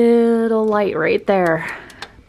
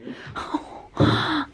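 delighted gasp; 9 semitones higher, 4 dB quieter than usual. Real, not acted. Delight at toothpaste.

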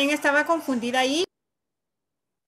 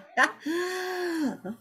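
soft laugh; 5 semitones higher, 5 dB quieter than usual. Real, not acted. Laughs at teacher's question.

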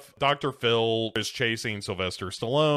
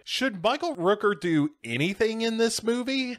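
high-pitched, mock-eager question; higher, playful pitch-meeting voice; imagined screenwriter pitching; 11 semitones higher than usual. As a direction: toss out a naive idea in a raised voice. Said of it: "somebody was like".

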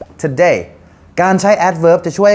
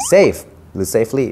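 1 slow demo; 3 semitones lower, much slower than usual. Shown as a demonstration.

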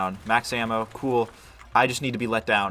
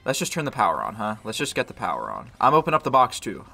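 usual speaking voice

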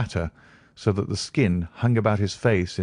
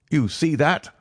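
higher reedy voice, urging; nervous patient, insistent; 8 semitones higher than usual. Acted. Patient showing Holmes into his bedroom, nervously insistent. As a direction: usher them along in a raised, edgy voice.

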